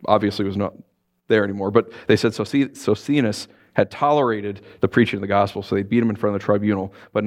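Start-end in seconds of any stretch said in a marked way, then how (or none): none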